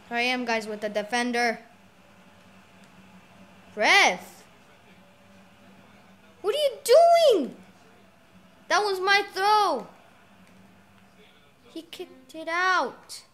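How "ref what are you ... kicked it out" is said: high, loud, slow protest; outraged at the ref. Real, not acted. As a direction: yell at the referee in disbelief. Protesting a throw-in call.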